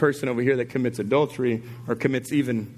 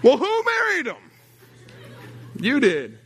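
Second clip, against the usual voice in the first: punchline; 9 semitones higher than usual. Real, not acted. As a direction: land the punchline with a rising jab. Punchline question.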